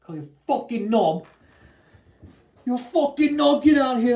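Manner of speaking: loud, high mocking shout; hammed-up angry Englishman shouting; mock English yell. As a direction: bellow it like an outraged Brit telling him off. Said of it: "They'd probably look at you and be like".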